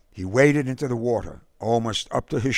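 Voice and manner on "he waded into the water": ordinary delivery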